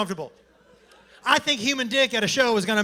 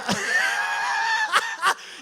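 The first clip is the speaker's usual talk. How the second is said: high shriek of laughter. Real, not acted. Laugh burst.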